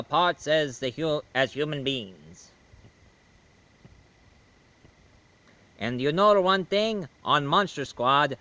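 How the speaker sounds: slow, put-on vampire count; Dracula voice. Dracula answering the reviewer, slow put-on count. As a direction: play the suave old Count, slow.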